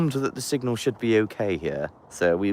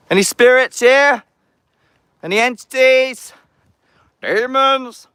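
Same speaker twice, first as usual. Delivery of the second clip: calling out; 14 semitones higher, 6 dB louder than usual. Acted. Calling out to spirits at the folly.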